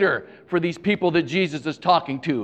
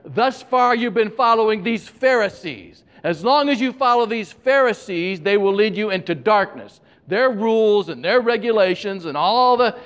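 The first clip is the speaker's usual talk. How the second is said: higher, urgent preacher's quote; Jesus addressing crowd; 5 semitones higher than usual. Acted. Jesus warning followers about the Pharisees, raised and emphatic. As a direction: raise the pitch and warn them.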